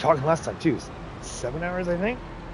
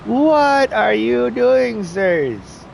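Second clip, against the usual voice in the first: slow, high and loud exasperated question; drawn-out scold; 6 semitones higher, 5 dB louder, much slower than usual. Acted. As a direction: stretch every word at them. Scolding game workers.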